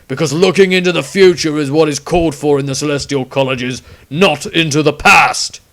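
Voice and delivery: loud, sneering scorn; spiteful. A narrator's reading. Witch hunter mocking the wizard's studies, loud spite. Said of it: "his spiteful outburst".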